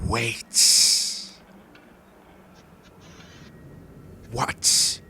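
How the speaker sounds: slow, drawn-out whispery monster voice; menacing creature, breathy and halting; spider creature. Acted. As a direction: hiss it out slowly, word by word, affronted. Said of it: "the creature itself go".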